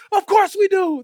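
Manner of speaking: high, loud mock crowd reply; crowd's shout. Crowd assures the tightrope walker, shouting confidently. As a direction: shout back with total confidence. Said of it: "And they go".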